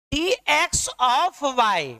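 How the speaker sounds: डी, एक्स of y said slowly, every letter separate; "डी, एक्स of y" pronounced deliberately, letter by letter; correct reading. Shown as a demonstration. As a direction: spell out डी, एक्स of y one syllable at a time. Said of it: "पहले ऊपर डी, तब नीचे डी".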